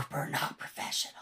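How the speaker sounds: hushed aside meant to be overheard; stage whisper. Pretend. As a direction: whisper it so the room still hears. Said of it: "I'm whispering into the mic".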